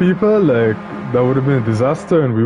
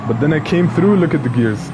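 ordinary delivery

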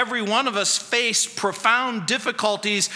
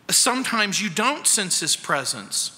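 ordinary talk, nothing marked

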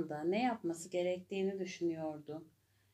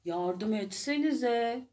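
higher, loud, slow call for help; hippo shouting; 4 semitones higher, 5 dB louder, slower than usual. A narrator's reading. Hippo shouting at the animals to help the elephant. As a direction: plead loudly to everyone. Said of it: "diye bağırdı hipopotam".

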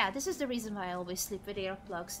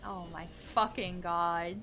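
ordinary delivery

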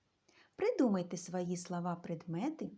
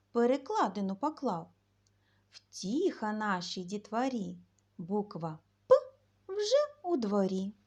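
louder, lively rhyme for the letter П; louder sing-song children's verse; 5 dB louder than usual. Acted. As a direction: recite it with bounce for little kids. Recites rhyme about the letter П.

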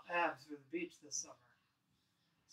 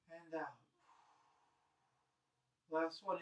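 guided breathing. Acted. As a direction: calmly lead the class through each breath. Leads slow breathing exercise.

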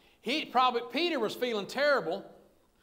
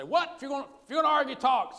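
higher, fast, exasperated demand; imitating an impatient husband picking at a quarrel; acted arguing; 5 semitones higher, 4 dB louder than usual. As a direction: push for an answer, high and hurried. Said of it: "I say".

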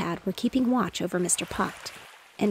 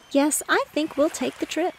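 much higher-pitched mother's voice; lightly voiced mother; 8 semitones higher than usual. A narrator's reading. Wife agrees to her husband's trip, lightly voiced mother. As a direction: raise the pitch for the wife's reply.

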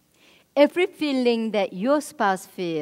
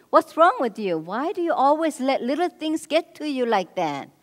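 exasperated scolding; 7 semitones higher, 8 dB louder than usual. Retold or imitated. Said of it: "The minimizing would be".

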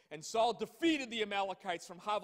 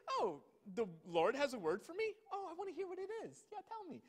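much higher, fast, breathless eagerness; eager Saul; 9 semitones higher than usual. Retold or imitated. Saul hearing the Lord has word, giddy high eagerness. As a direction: gush like an excited child. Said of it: "He's like".

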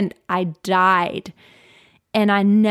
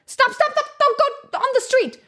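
put-on high-pitched yell to stop a child; demonstrated parent's emergency shout; urgent warning shout. Shown as a demonstration. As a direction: call out as if a child is running into danger. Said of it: "When you have little children, sometimes you have to go like".

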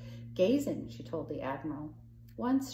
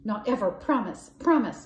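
louder, higher frantic plea; Kizzy begs not to be sent; begging; 5 semitones higher, 10 dB louder than usual. A narrator's reading. Kizzy pleading with Miss Brooke, louder, high and frantic. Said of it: "begged Kizzy".

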